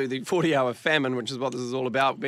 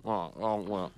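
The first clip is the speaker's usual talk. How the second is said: normal talking voice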